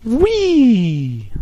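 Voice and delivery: high, delighted whee; excited whee. Pretend. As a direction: squeal it like sliding down. Dropping down playfully.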